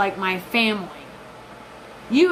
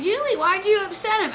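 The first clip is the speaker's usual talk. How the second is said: higher, accusing family voice; blaming family voice; 8 semitones higher than usual. Retold or imitated. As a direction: whine the blame at Julie. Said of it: "I always got blamed".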